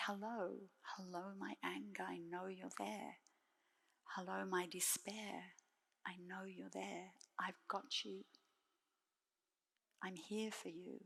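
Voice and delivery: put-on soft, hushed and gentle, like cradling a crying baby; softly reassuring inner voice; tender soothing whisper. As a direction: whisper kindly to your own pain. Soothing one's own anger, despair.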